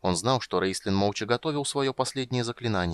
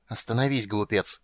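ordinary delivery

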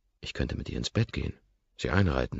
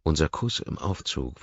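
plain reading voice

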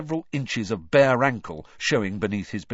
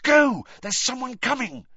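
sharp, high and loud Go; urgent warning shout; urgent cry; 13 semitones higher, 5 dB louder than usual. Acted. Harry urging Sirius away, loud and high. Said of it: "he hissed at Sirius".

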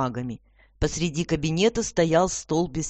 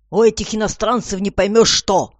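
raised, exasperated grumble; grumbling; 6 semitones higher, 7 dB louder than usual. A narrator's reading. Commissioner baffled by foreigners, grumbling. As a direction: complain louder, higher, at nobody. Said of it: "пробурчал комиссар".

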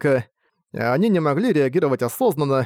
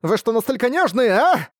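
scolding schoolgirl; 9 semitones higher than usual. Acted. Girl scolds the whole class.

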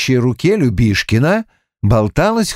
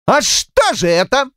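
high-pitched, spiteful demand; angry pressing; 12 semitones higher than usual. A narrator's reading. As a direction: press the question angrily, voice high. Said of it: "страшная в своей злобе". (наседает со злобой, высоким голосом)

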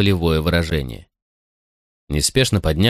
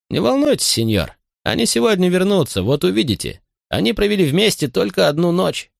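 high, falsely reassuring servant; confident butler; 10 semitones higher than usual. Acted. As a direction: sound sure of yourself, sly. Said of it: "напустив на себя уверенный вид".

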